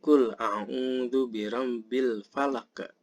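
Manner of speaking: slow chant, final q of "falaq" bounced; slow recitation ending "falaq" with released q; qalqalah on falaq. Shown as a demonstration. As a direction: let the q in "falaq" echo as a short extra puff. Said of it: "itu harus dibunyikan".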